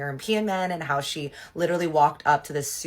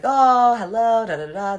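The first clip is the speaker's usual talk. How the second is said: loud, high mock-delighted hello, easing off; effusive greeting; 8 semitones higher, 10 dB louder than usual. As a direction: imitate a gushing reunion greeting.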